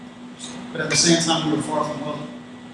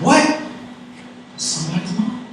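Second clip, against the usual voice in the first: mom's voice; 4 semitones higher than usual. Acted. Mom in the driver's head reproaching road anger, imitated voice. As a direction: imitate mom's indignant reproach, pitch jumping up. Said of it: "I hear my mom's voice".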